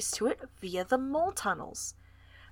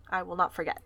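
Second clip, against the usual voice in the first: usual speaking voice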